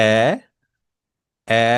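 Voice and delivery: এ held as the German ä vowel; a umlaut sound. Shown as a demonstration. Teacher demonstrating the a umlaut, clearly. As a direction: show the ä sound with এ. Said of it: "আ umlaut-এর উচ্চারণটা হয় এরকম".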